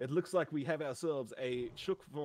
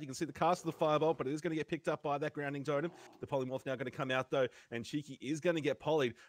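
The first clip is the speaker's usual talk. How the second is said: lively higher play-by-play; esports caster; 4 semitones higher than usual. Acted. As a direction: call the deciding round live. Live tournament play-by-play.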